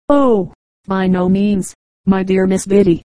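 ordinary delivery

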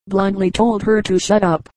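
plain reading voice

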